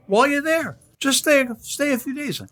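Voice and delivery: high, light voice for son's advice; imitating son. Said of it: "He says".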